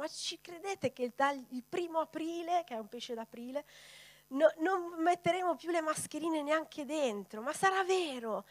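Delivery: laughing it off with friends; joking disbelief. Retold or imitated. Retelling a morning joke with friends, amused disbelief. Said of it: "ridavamo e dicevamo".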